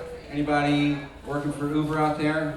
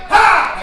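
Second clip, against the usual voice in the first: startled yelp; 12 dB louder than usual. Retold or imitated. Panicked stopping radio.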